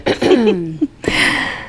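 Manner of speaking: short rough throat clear. Real, not acted. After coughing talk.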